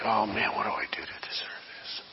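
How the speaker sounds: fast, barely audible whisper; preacher voicing a despairing listener, whispered. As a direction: mutter it to yourself in despair. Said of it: "Do you ever feel like".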